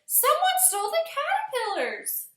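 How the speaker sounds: high, loud alarmed outburst; alarmed cry. A narrator's reading. Girl telling teacher the caterpillars are stolen, high alarmed cry. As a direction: blurt it out in a panic. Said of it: "I cried".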